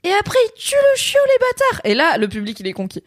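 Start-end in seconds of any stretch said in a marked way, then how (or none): 0.0-1.8 high, fast indignant outburst; outraged. Acted. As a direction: explode in mock indignation. Outrage at puppy death.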